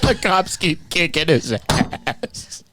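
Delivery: real laughter breaking up the words; laughing. Real, not acted. Friend repeating the punchline after cracking up, laughing. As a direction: squeeze the line out high and slow through laughter.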